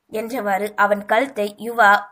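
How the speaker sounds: ordinary delivery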